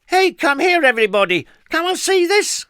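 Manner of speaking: high, loud gleeful shout to the crowd. A narrator's reading. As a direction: call everyone over at full voice. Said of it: "she shouted at the top of her voice".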